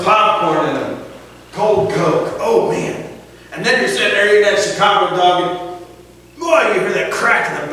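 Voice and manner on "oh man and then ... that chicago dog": ordinary talk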